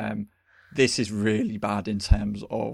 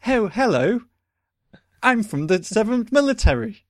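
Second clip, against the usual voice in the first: much higher and louder, a hammed-up lady in charge; mock officer lady; 11 semitones higher, 7 dB louder than usual. The person in charge greets the soldier, hammed-up high officer voice. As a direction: play the commanding woman as a silly caricature. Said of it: "she's all like".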